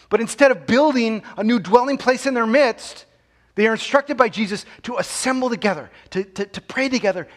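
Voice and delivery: fiery sermon climax, building; preaching at full force. Acted. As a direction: hammer the victory home from the pulpit.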